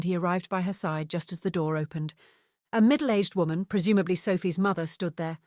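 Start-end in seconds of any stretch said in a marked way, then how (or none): none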